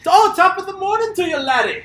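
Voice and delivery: high, loud mock Scottish accent; hammed-up Scottish brogue. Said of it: "What if this guy was Scottish?".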